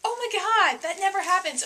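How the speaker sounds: high, loud amazed outburst; very excited. Real, not acted. Vlogger amazed by own rare shopping haul, very excited. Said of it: "I'm very excited".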